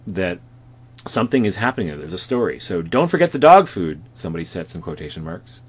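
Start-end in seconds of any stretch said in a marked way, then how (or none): 2.8-4.0 high, loud call; bright shouted reminder. Acted. Reading a caption quote aloud.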